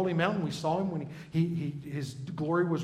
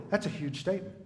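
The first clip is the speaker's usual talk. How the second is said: ordinary talk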